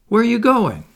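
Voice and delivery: high, loud anxious cry; cried with misgiving. A narrator's reading. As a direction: call out in sudden alarm. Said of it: "she cried with misgiving".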